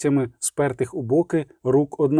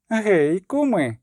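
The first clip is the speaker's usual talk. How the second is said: raised, merry hail; a light shout to the crowd; mild call out; 4 semitones higher, much slower than usual. Acted. Iskra calls the villagers again, mock hail. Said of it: "знову крикнула Іскра".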